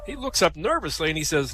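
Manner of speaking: ordinary delivery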